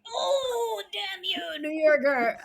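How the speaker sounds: high, hammed-up shout of outrage; mock outrage. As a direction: curse the magazine in mock fury. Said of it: "And I was like".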